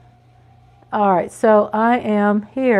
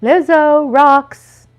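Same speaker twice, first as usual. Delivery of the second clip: excited cheer; 5 semitones higher, 8 dB louder, slower than usual. Real, not acted. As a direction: shout her name like a fan. Cheering for [name] on stream.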